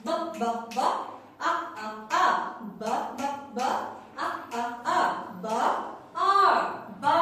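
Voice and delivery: ব and আ in short separate beats, then blended into বা; phonics sounding-out. Shown as a demonstration. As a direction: chant ব, ব, ব, আ, আ, আ, then join as বা. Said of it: "এভাবে বলবো আমরা".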